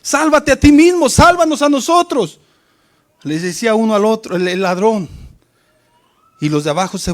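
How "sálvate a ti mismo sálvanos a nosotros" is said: jeering, rising higher and louder toward the end; mock crowd jeer building to a shout; mocking taunt. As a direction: taunt him like a scornful crowd. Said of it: "se burlaban y le decían".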